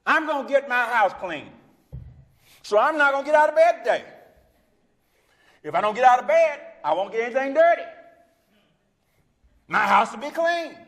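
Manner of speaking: high, loud, foolishly proud declaration; hammed-up stubborn fool, loud and high; mock excuse-maker. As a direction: crow absurd logic at the top of your range. Mocking a stay-in-bed excuse maker.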